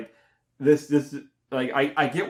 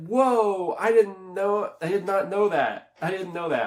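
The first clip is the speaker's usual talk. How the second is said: high-pitched replayed astonishment; wide-open surprise, higher and insistent; amazed reaction; 7 semitones higher, 3 dB louder than usual. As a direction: act out being floored by a twist. Re-enacts reaction to plot twist.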